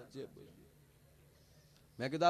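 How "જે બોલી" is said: faint echo of the word જે બોલી, much softer and breathed; mock echo. Words bounce back off the wall as a whispered echo. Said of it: "જેમ દિવાલમાં દડો જાય ને પાછો આવે".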